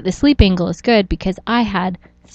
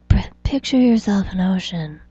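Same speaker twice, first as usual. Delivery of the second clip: lightly hammed-up meditation leader, slow at the end; a hint of an overdramatic yoga guide; mock guru; slower than usual. Podcast host mocking meditation apps, lightly overdramatic guru voice. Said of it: "That was trying to be a, like, an overly dramatic yoga meditate".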